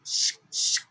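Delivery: unvoiced shh, shh; imitated whisper shushing. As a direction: shush softly with breath only.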